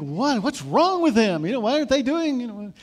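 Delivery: mock fan shouting at his team; frustrated fan. Sports fan yelling at the team on TV, exasperated. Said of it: "you think".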